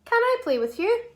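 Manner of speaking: quick and bright, running the words together; speedy. Shown as a demonstration. Teacher saying the sentence speedily for children to copy. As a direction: rattle it off fast and high. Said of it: "do it a bit speedily this time".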